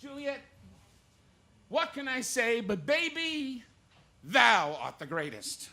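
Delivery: high, loud showman sign-off; big variety-show host farewell; Gleason sign-off. Retold or imitated. Impressionist as Gleason closing the show, loud showman. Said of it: "Jackie Gleason doing Shakespeare".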